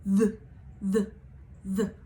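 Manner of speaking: strong voiced th demo. Shown as a demonstration. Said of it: "the louder sound".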